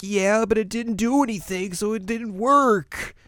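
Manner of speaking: high, squeaky little-character voice; Shippo. Retold or imitated. Shippo answers about the antidote, high-pitched and whiny. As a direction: pitch it way up, drawing out the end. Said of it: "And Shippo's like".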